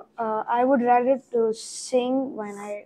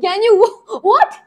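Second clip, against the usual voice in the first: surprised; 10 semitones higher, 9 dB louder than usual. Real, not acted. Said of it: "Do it again".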